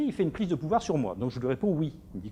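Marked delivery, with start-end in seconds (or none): none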